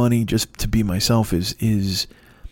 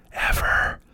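whispered repeat. Shown as a demonstration. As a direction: whisper the word Ever. as an aside.

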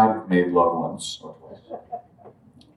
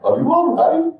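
much higher, louder worried asking; concerned question; 6 dB louder than usual. Retold or imitated. Man leading the puja asks the weeping narrator, high concerned voice. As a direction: check on him gently but urgently. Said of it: "He asks".

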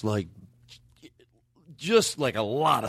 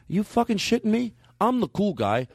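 higher, indignant cool guy; mock outrage, pitched up; offended cool guy; 9 semitones higher than usual. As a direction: huff like your cool title was stolen. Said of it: "this is him telling the story".

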